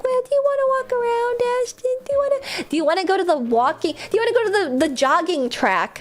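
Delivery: high, far-off mom voice nagging to walk; imitating mother. Streamer imitating her mother asking to walk again. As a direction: mimic a mother pestering her daughter. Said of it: "my mother being like".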